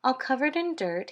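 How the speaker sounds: plain reading voice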